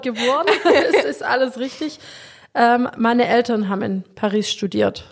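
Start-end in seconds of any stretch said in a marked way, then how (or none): 0.7-2.0 laughing it out while confirming. Real, not acted. Guest laughing while confirming her Paris birth. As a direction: chuckle through the words.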